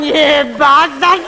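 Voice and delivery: slow hammed-up snarl; mock fury. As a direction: drag the insult out like a madwoman. Furious wife with axe.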